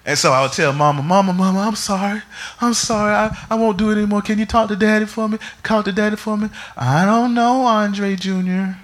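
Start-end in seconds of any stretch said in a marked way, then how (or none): 1.0-6.5 put-on high, fast pleading little boy; pleading child. Boy begging mother in story. 6.8-8.8 high, worried mother's voice; imitating mom. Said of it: "That's exactly how my mom sounds".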